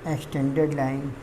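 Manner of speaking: low, very soft, halting read-out; slow dictation. Real, not acted. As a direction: say it softly to yourself, word by word, with long pauses. Murmurs definition aloud, likely while writing.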